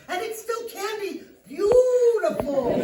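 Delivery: high, loud, stretched-out BEAUTIFUL; desperate placating. Acted. Psychologist promising armed client it can be beautiful, desperate placating.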